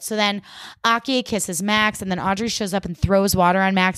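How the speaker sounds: normal talking voice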